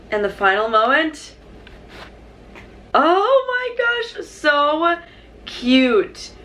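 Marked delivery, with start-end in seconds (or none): 2.9-6.3 much higher, slow drawn-out gasp; awed squeal. Real, not acted. Final reveal of the wallet.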